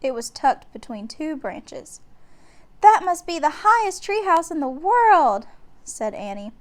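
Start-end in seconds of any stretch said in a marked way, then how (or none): 2.8-5.5 put-on higher, louder little girl in wonder; a thrilled young sister gasping at the tree house; amazed girl; excited girl. A narrator's reading. Annie voiced, girl character.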